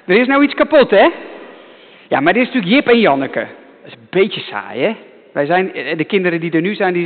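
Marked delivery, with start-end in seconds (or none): 0.0-1.1 imitating a cross mother, a bit louder; mock cross mother. To the children, a mother scolding about something broken, mock angry. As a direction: play the scolding mum for laughs. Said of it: "moeders worden altijd boos".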